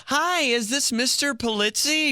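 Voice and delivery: high, slow, overly polite phone voice; super proper caller. Retold or imitated. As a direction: play a stiff formal caller. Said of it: "trying to be super proper".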